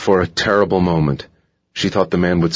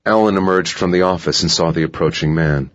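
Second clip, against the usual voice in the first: ordinary delivery, nothing marked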